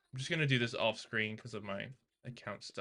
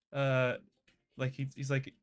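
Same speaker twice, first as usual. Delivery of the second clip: ordinary delivery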